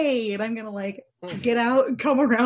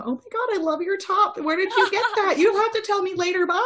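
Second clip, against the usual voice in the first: higher, sugary fake friendliness; fake sweet voice; 8 semitones higher than usual. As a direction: gush over her top, all fake. Fake friendly chat to the mom.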